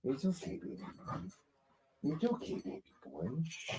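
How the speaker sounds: high, hushed, cooing to a pet. Real, not acted. Owner hushes her hyper dog Clubber, soft high cooing.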